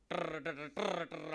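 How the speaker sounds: rolled tongue drum imitation; lightly voiced drum roll; snare drum roll.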